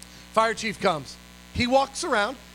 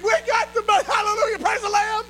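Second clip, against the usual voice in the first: high, loud hallelujah cry; joyful shout; 11 semitones higher, 4 dB louder than usual. Retold or imitated. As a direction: shout praise like you can't contain it. Celebrating the money.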